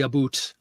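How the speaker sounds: "about" with an oo vowel, like "a-boot"; imitated Canadian "about"; Canadian "aboot". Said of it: "she absolutely does the".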